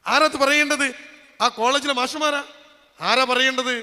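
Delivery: loud, high demand for an answer; forceful rhetorical question. Real, not acted. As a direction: thunder the question at the congregation. Same question repeated at full force.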